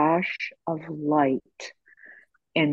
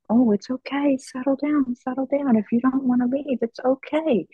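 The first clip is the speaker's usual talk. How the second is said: higher, gentle calming voice; soothing reassurance; 10 semitones higher than usual. Retold or imitated. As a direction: nurse soothing her aunt, mildly reassuring. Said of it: "And I was like".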